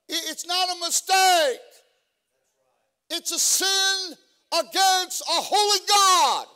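rising fiery preacher's cry, building to a shout; preaching at full force. Acted. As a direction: build from pointed questions to a roaring climax.

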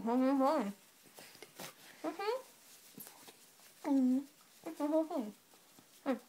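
barely intelligible through stuffing; cheeks stuffed full. Real, not acted. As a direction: mouth crammed full. Mouth fully crammed.

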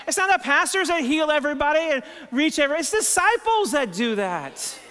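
high, loud preacher's climax; full-force preaching. Acted. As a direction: build it up and proclaim.

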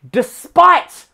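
despite said high and loud, rising; stress on despite. Real, not acted. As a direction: punch the word despite. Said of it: "punched keyword".

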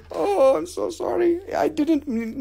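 high, squeaky pretend remorse; hammed-up little apology; mock apology. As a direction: go high for a play-acted sorry. Mock apology after pretend threat.

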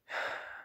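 soft, heavy exhale; real breathy sigh. Real, not acted. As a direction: let out a quiet sigh. Pauses before admitting his feelings.

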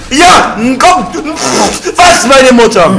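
high, loud cheer; excited game shout. Real, not acted. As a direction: yell with a win in reach.

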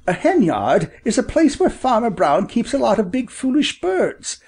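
high storybook bird voice; father bird, explaining. Acted.

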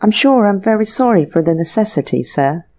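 ordinary delivery, nothing marked

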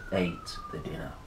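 hushed whisper. A narrator's reading. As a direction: tell it like a secret. Spooky narration.

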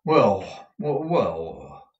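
falling, bemused wells; mildly puzzled character muttering. A narrator's reading. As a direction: mull it over with falling tones. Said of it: "muttered Newman, as if he were a little puzzled".

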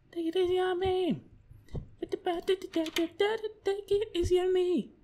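high pleading falsetto; mock plea. Gamer pleading with the game, high mock falsetto. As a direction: beg the game in a silly high voice.

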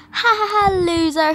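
high, loud jeering ha-ha; mocking laugh. Bully teasing the new girl over Melody, mocking laugh. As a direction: jeer at the loser, high and loud.

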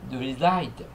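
"resigned" said re-ZIGND with no G sound; "resigned" without voicing the G; G silent. Shown as a demonstration. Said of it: "G不发音".

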